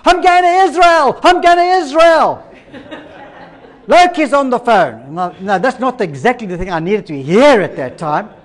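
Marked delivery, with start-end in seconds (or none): 0.0-2.4 high, loud mock shriek of joy; screaming with excitement. 3.9-5.1 high, loud, drawn-out imitation of an excited shout; excited screaming.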